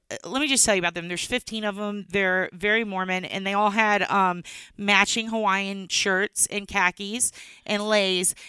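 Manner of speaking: normal talking voice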